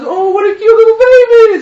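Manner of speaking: very high, loud cooing; gushing baby talk. Acted. Passer-by to mother with baby carriage, gushing. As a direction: squeal over the baby in exaggerated delight.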